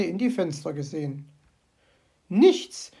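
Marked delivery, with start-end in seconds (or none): 2.3-2.9 indignant magpie chatter. Acted. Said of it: "schwatzte sie vor sich hin".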